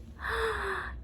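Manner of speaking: big audible gasp of mock surprise; theatrical gasp for a child; excited gasp. Shown as a demonstration. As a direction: suck in air as if amazed.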